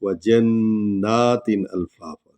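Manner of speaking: slow tajweed recitation with the doubled noon held long; Quran recitation. Shown as a demonstration. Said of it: "ये नून को हम लंबा कर रहे हैं". (क़ुरआन की तिलावत, जन्नातिन में नून लंबा)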